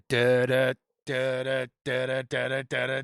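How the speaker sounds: low rhythmic da-da imitation; low chanted sound effect; imitated approaching rumble. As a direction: mimic the field bearing down, low and repeated. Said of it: "did you hear it?".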